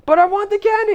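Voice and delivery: very high-pitched mock child's whine; whining kid. Kid pleading with parent for candy, whiny and high. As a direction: play a tantruming kid, voice way up.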